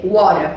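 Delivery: WATER with the T forgotten; American pronunciation. Shown as a demonstration. Teacher shows the forgotten T, American WATER. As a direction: say "water" low, the T just a light tap. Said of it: "a gente esquece o T".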